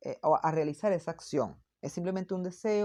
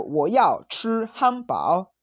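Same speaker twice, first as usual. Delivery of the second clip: 我要吃汉堡 said firm and louder, a strong wish; demanding tone; 2 semitones higher, 5 dB louder, slower than usual. Shown as a demonstration. Teacher showing students a demanding request, firm and loud. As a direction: state 我要吃汉堡 like you really want it. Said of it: "estoy expresando como un fuerte deseo".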